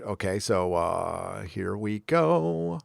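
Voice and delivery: very slow, stretched and soft; drawn-out lead-in. Real, not acted. Reviewer cueing up a video for viewers, slow drawn-out lead-in. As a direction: stretch it out as the clip loads. Drawn out while starting the clip.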